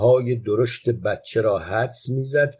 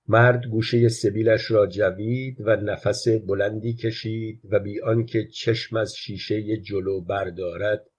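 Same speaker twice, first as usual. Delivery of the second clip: ordinary delivery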